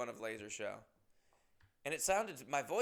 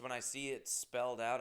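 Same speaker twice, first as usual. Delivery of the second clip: ordinary talk, nothing marked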